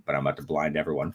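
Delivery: usual speaking voice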